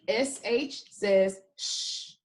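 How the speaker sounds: slow, with sh as a long hiss; sh sound. Shown as a demonstration. Teacher modelling the digraph sound slowly. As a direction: hold the sh sound out. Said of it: "Say it with me".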